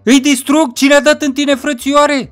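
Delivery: high, loud outraged character voice; fired-up avenging friend. Acted. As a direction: demand names, loud and pitched right up. Friend vowing revenge in story.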